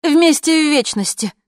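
high, loud, venomous outburst; angry, high retort; spiteful blurt. A narrator's reading. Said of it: "зло выпалила Джульетта".

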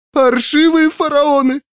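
very high, drawn-out tearful wail; sobbing. A narrator's reading. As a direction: wail a curse through tears in a high voice. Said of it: "прорыдала она".

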